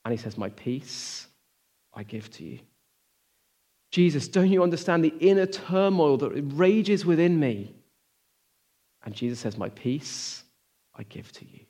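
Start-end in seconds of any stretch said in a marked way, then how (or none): 0.4-2.6 soft, tender answer fading to a breath; calm the worried heart, softly; quiet gentle reply. Pretend. Jesus answering an overwhelmed believer, softly and tenderly. 3.9-7.8 1 pleading believer. Pretend. Anxious believer voiced pleading Jesus. 9.6-11.6 soft, tender answer fading to a breath; calm the worried heart, softly; quiet gentle reply. Pretend. Jesus answering an overwhelmed believer, softly and tenderly.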